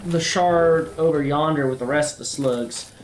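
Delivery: a country-boy Southern accent played for laughs; put-on Southern accent. Gamer chatting to viewers in a mild mock Southern accent. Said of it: "I feel like I should be offended by this accent".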